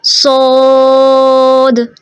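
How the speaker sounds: सोद with a light hiss at the start, then held very long and heavy; heavy saad. Shown as a demonstration. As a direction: start सोद with a whistle and stretch it. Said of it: "starting में स हल्की सी आती है".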